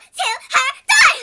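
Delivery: high, loud outburst; outraged shout. Real, not acted. Telling viewers the cheater's plan, shouting in outrage. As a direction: blurt it out in outrage. Climax of the reveal.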